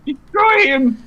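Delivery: squeaky, laughing command; high gleeful creature. Acted. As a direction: shriek it with glee. Eighties fantasy scene.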